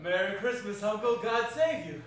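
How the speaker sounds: loud, hearty nephew's greeting; cheerful. Acted. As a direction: burst in with cheerful good wishes. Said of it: "cried a cheerful voice".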